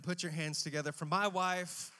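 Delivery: loud, high-pitched shout to the crowd; rousing introduction. Real, not acted. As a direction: whip up applause for a beloved guest. Rousing stage introduction of wife.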